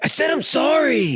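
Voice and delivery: high, drawn-out whiny kid imitation; kid's grudging apology. Parent imitating kids' fake apology, high drawn-out mock yell. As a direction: mimic a sulky kid forced to apologize.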